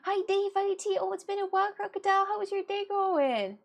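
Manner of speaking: high, sing-song welcome voice; cheery greeting. Real, not acted. Streamer greets returning chatters, high sing-song greeting. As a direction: greet them way up high and bright.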